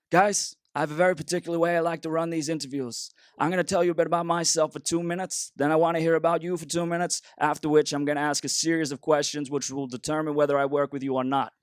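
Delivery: a fussy Scandinavian interviewer in a put-on accent. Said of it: "so this is my best Scandinavian accent".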